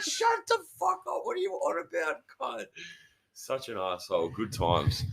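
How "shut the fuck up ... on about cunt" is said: very high, squawked comeback; hammed-up high-pitched exasperation; mock indignant retort. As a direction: imitate snapping at an annoying mate. Retells snapping back at the prankster.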